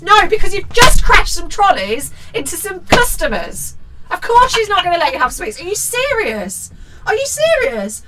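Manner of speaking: high, loud indignant rant; outraged scolding. Acted. Host scolding the child for wanting sweets, outraged. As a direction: shout down the child in disbelief.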